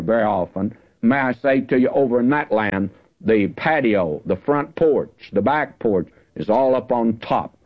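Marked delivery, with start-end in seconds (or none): none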